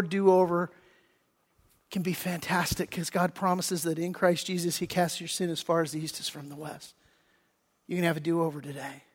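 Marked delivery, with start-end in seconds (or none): 1.9-9.0 much softer, gentle altar-call voice; soft invitation. Real, not acted. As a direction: draw them in quietly and tenderly.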